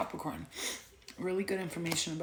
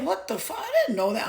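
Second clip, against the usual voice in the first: shocked reaction; 9 semitones higher, 5 dB louder than usual. Retold or imitated. Friends shocked by hygiene lesson.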